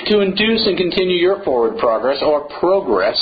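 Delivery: "progress" said first with an American "prah" vowel, then with a British "proh" vowel; mock-multilingual show-off of "progress"; two pronunciations. Shown as a demonstration. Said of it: "See, I'm multilingual".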